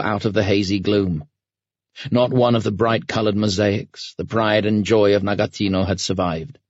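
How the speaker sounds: usual speaking voice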